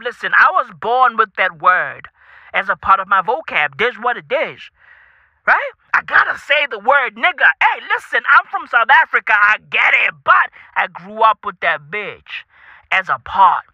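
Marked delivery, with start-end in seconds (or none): none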